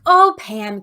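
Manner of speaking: loud delighted gush over the name Pam; mock gushing. Rachel selling the yacht to the bidder, loud gushing. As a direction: sell the name like it's wonderful. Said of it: "It's like".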